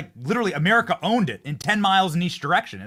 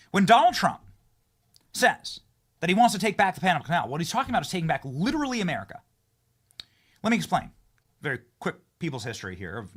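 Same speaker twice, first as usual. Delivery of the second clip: a lightly heightened, dramatic podcast host voice; punchy host monologue. Acted. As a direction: tell it like a breathless discovery to your listeners. Host's punchy solo monologue.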